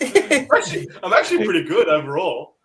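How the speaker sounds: breaking into a laugh as he starts; real laugh bubbling over the opening words; laughing through the words. Real, not acted. Laughing at the teasing.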